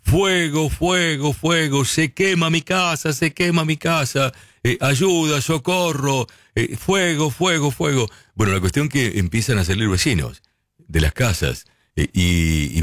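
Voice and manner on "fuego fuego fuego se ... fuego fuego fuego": play-acting a panicked neighbour calling for help, pitched way up; a staged alarm cry, high but without volume; mock shout of fire. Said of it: "y empieza a gritar".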